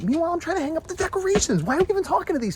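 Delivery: high, loud frustrated complaint; exasperated venting. Real, not acted. Parent venting about interrupted decorating, high-pitched loud exasperation. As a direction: let the exasperation burst out. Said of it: "now I vented to you".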